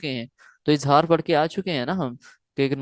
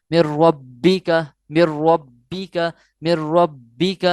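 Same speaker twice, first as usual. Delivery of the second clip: مر ربک very slow, louder, the ب bounced; with force and zeal; 4 dB louder, much slower than usual. Shown as a demonstration. Teacher demonstrating to students: مر ربک recited slowly with force. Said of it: "زور دے کر ظاہر کر کے یا جوش سے پڑھیں گے".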